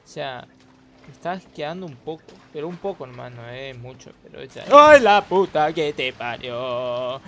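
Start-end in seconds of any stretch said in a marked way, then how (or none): 4.7-7.2 loud, slow frightened cry; scared outburst. Real, not acted. Gamer spooked by a shadow, cursing loudly. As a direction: yelp it out in real fright. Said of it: "me asusté".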